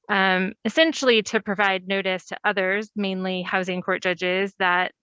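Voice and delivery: ordinary talk, nothing marked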